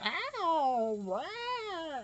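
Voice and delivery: high, yowling imitation of a cat in heat; cat howl. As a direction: yowl it like a cat. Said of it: "howling in the middle of the night".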